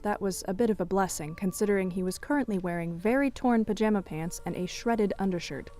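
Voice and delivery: normal talking voice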